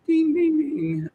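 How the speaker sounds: very high sing-song ding ding; playful sing-song alert; mock alarm bell. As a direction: ring it out like a bell. Signal alarm in his head.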